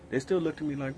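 ordinary talk, nothing marked

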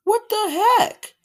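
high, slow mock disbelief; baffled what the heck. Reacting to trash costumes, high slow baffled. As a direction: act stunned at the trash costumes. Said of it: "I'm like".

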